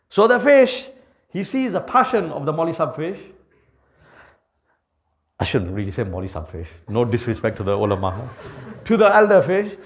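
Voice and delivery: ordinary talk, nothing marked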